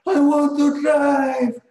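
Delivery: high, drawn-out full-volume shout; Carl. Retold or imitated. Showing how Carl demanded to drive, imitated yell. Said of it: "Dia teriak gitu, kayak, kayak gini".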